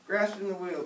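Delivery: usual speaking voice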